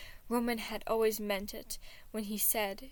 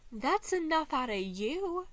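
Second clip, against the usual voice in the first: higher, slow sneer; 7 semitones higher, 4 dB louder than usual. Acted. Thomas shutting down Logic with a curled lip. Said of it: "curling up his lip".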